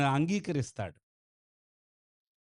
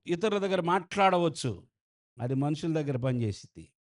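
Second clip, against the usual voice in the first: ordinary talk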